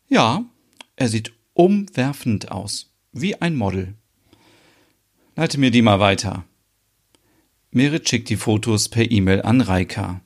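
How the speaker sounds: usual speaking voice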